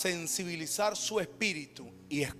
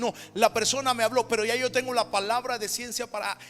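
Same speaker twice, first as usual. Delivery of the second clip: loud, driving preacher's cry; fiery preaching; 3 semitones higher, 9 dB louder than usual. Acted. Pastor telling the congregation where God speaks, at full force.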